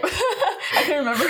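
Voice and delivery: laughing through the words; giggling while speaking. Real, not acted. Laughing at forgetting.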